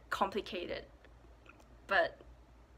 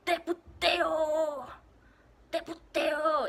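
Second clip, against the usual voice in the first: high, slow, loud mock challenge; picking a fight; 7 semitones higher, 6 dB louder, slower than usual. Daring someone to fight in Mexico, mock challenge. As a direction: hurl Te puteo as a taunt. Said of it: "if you want to pick a fight".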